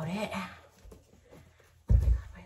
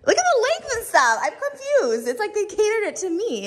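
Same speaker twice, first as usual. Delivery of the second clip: high, loud delighted disbelief; amazed; 13 semitones higher, 13 dB louder than usual. Real, not acted. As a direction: gush about the perfect fit. Amazed at how clothes fit.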